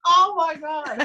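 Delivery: very high, loud gasp of amazement; retold astonishment. Diver reliving the sight of a hand-sized tooth, astonished. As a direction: blurt it out sky-high, half laughing.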